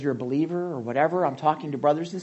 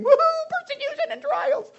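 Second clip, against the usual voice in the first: much higher sarcastic whoop; mock cheer; 4 dB louder than usual. Preacher mocking a joyful welcome of trials, sarcastic whoop. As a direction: cheer it like a party, ironically. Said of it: "Was I thinking".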